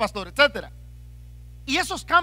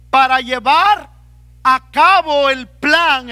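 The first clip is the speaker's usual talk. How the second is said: full-force preaching; 8 dB louder than usual. Acted. Pastor preaching to his brothers on outward focus, loud and forceful. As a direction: drive each phrase home to the church.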